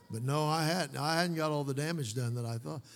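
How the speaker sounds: ordinary talk, nothing marked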